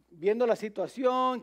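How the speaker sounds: usual speaking voice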